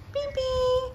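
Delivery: very high, playful beep-beep; car horn imitation. Mother showing baby the car, playful horn noises. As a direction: squeak out a toy car horn.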